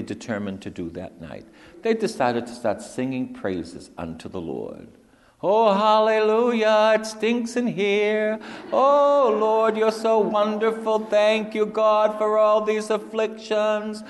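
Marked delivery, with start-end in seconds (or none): 5.4-14.0 very high, loud, half-sung cries of praise; ecstatic prisoner praise. Acted. Paul and Silas praising God in the jail at midnight, ecstatic and high. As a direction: sing out joyful praise from a stinking cell.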